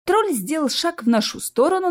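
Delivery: ordinary delivery, nothing marked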